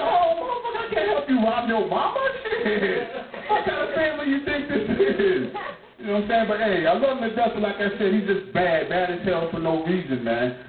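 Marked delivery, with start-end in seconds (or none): 0.0-5.5 high, squealing disbelief on oh; mock-scandalized, voice jumping way up; shocked outrage. Uncle reacting to nephew's plan to rob his mother, shocked.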